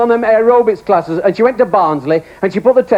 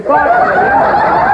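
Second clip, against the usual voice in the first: laughing. Retold or imitated. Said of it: "he said".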